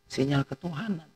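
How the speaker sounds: hushed answer; softly. Shown as a demonstration. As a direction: murmur "Sinyal ketuhanan" as a quiet reminder. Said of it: "tidak kenal apa?".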